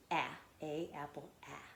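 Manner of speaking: short A as in apple, "ah"; "ah" said as a clean short A; short A sound. Shown as a demonstration. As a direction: model "ah" as the short vowel opening apple. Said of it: "makes it a short A sound".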